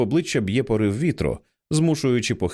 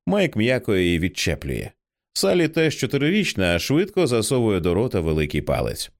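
plain reading voice

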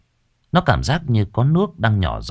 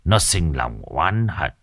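low ominous sorcerer voice; ominous old master; 4 semitones lower than usual. Acted. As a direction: warn of the river ghost darkly. Master explains the ghost.

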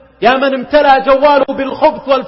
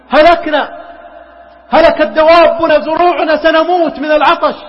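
higher and louder pleading for rescue; desperate cry; 6 semitones higher, 5 dB louder than usual. Retold or imitated. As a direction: wail to the prophet for rain. People crying to Moses for help. (استغاثة يائسة بصوت عالٍ)

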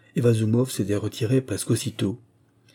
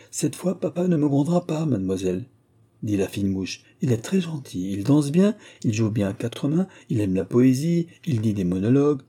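put-on higher, lively girl's voice; sly younger daughter, pleased; 5 semitones higher than usual. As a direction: list merits in a sprightly, girlish pitch. Sly girl lists the suitor's merits. (jeune fille malicieuse, voix plus aiguë)

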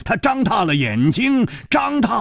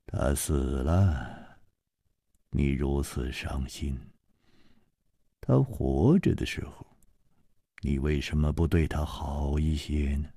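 楚留香 sighing; 13 semitones lower, 9 dB quieter than usual. Acted. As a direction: sigh it out low and gentle. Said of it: "楚留香叹道". (楚留香低沉轻叹)

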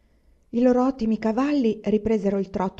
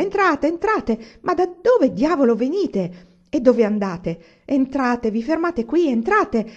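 loud, higher, tumbling welcome; excited; 6 semitones higher, 7 dB louder, slower than usual. Acted. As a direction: gush at the visitors without waiting for answers. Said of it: "Era così eccitato che non aspettava". (accoglienza eccitata, più alta e forte)

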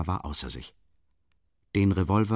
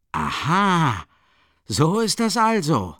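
höher, langsam, hämisch überrascht; verwundert; 9 semitones higher, 6 dB louder, slower than usual. A narrator's reading. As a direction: gespielt verblüfft, mit Hohn. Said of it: "rief er verwundert aus". (verwundert und höhnisch ausrufen)

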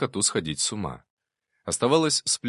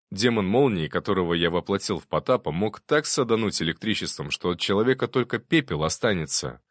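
usual speaking voice

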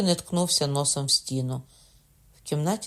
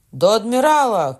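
higher, louder echo of the call; shouting after; 8 semitones higher, 10 dB louder than usual. A narrator's reading. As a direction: top the first cry, higher and louder. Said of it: "кричав за ним Лагрюєр".

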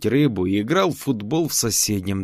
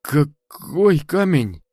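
frightened question; 5 semitones higher, much slower than usual. Acted. Boy frightened by shaman.

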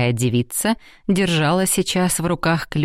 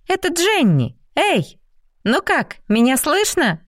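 much higher, eager calling out; excited calling; 12 semitones higher than usual. Acted. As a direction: call out brightly over the line. Jenny calling through device. (взволнованно кричит в передатчик)

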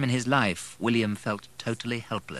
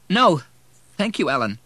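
high, loud no easing off; shaken, near tears; 9 semitones higher, 7 dB louder than usual. A narrator's reading. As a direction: decline while fighting back tears. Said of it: "paused to draw a shaky breath".

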